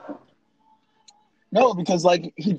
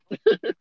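high squeal laugh; 3 dB louder than usual. Real, not acted. Cracking up.

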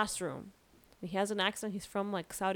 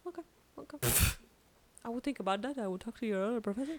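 quiet professor; 2 semitones higher, 5 dB quieter, faster than usual. Retold or imitated. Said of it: "he talks at this volume".

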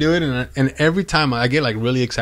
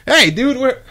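startled outburst; 12 semitones higher, 5 dB louder than usual. Real, not acted. Reacting to co-host's sneezes.

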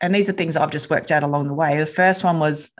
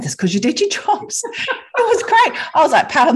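laughing through the words, much higher; 12 semitones higher, 4 dB louder than usual. Real, not acted. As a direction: giggle it out, delighted with the morning. Laughs at her morning win.

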